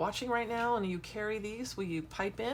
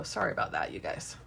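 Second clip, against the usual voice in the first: whispering; 11 semitones lower than usual. Real, not acted. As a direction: drop to a whisper for the aside. Whispered aside about camera shake.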